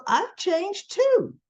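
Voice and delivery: high, slow plea; mock whiny Tucker. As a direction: insist like a hurt kid. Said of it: "And he goes".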